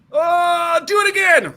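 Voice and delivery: drawn-out high howl into the words; mock pained wail. Kicked man begging for another, high mock agonized cry. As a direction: wail it like a man badly hurt, hammed-up.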